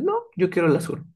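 quick mock tantrum, a hint of brat; bratty child. Child refusing the offered colours, mildly bratty. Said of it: "tú eres un niño berrinchudo".

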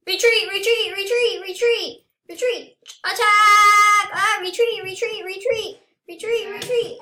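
high, loud frantic yelling; panicked calling. Pretend. Player flees an attack in game, panicked calling. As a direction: yell the alarm in a high voice.

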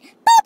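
very high, very loud bleep; sharp censor bleep; bleep sound. Pretend. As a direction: make a censor beep noise. Bleeping the word.

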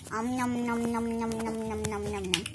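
playful pretend munching; nom nom chomping. Pretend eating toy food.

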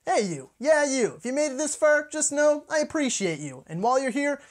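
high, loud, hyped call-out; excited outro call. Acted. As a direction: grab the viewer's attention loudly. Post-credits call to viewers.

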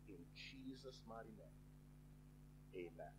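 reverent prayer. Real, not acted. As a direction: bring the prayer gently down to a hushed close. Closing the opening prayer.

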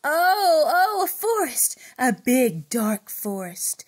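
loud dramatic exclaim, slowing. Acted. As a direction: announce the forest, then slow and dark. Loud story discovery.

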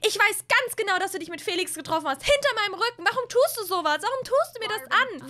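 high, loud hurt fury; betrayed, let it all out; furious sister. Acted. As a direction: Stella Ann accusing her sister of betrayal. Sisters' acted quarrel.

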